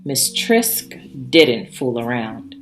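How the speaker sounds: usual speaking voice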